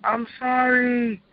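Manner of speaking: high, slow begging wail of a demon; pleading demon. Retold or imitated. Demon begging its Master not to punish it, high pleading wail. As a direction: beg your master for mercy, way up high. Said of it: "the demons was hollering".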